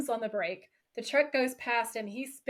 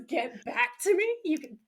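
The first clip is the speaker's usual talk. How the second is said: high-pitched indignant echo, imitated; pissed; 6 semitones higher than usual. Host retelling Dean's reply to being hung up on, pissed. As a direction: mimic someone offended at being put off. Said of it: "Dean's pissed".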